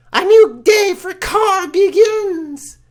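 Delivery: slow, very high, loud exultant proclamation; gleeful cry. Acted. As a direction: crow it out in triumph. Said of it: "cries with glee".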